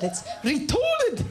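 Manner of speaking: high, drawn-out mock South African; South African accent. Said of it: "if there's any South Africans".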